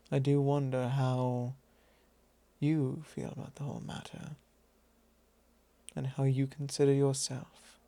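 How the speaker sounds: usual speaking voice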